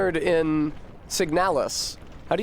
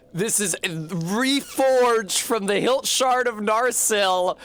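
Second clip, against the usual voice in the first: grandiose Aragorn; 6 semitones higher, much slower than usual. Retold or imitated. Streamer retelling Aragorn to chat, slow and grandiose. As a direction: proclaim the sword's lineage like a pompous hero. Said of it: "Aragorn's like".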